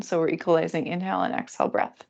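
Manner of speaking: ordinary talk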